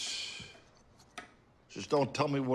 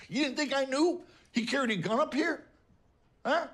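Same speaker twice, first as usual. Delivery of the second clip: high-pitched, accusing challenge; angry accusation; 11 semitones higher than usual. Acted. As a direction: throw it out as an indignant question. Angry confrontation over the daughter's husband.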